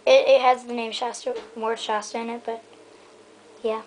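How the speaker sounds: ordinary talk, nothing marked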